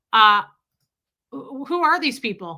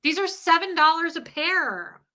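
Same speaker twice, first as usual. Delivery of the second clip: high-pitched, hammed-up indignant protest; mock indignation; 6 semitones higher, 4 dB louder than usual. Comedian defending costly underwear to partner, mock indignant. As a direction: push it high, as if outraged. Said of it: "I was like".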